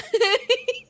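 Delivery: squealing laugh; high burst of laughter. Real, not acted. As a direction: let out a high cackle. Loud laugh after story.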